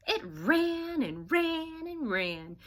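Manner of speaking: slow, stretched-out telling of the running; drawn-out narration. A narrator's reading. Teacher reading to class, stretching the words slowly. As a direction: let each ran roll on and on.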